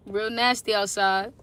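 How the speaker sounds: ordinary talk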